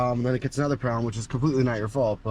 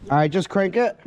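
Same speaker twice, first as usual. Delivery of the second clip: raised voice calling across the shop; 9 semitones higher, 5 dB louder than usual. Real, not acted. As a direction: call out to the worker.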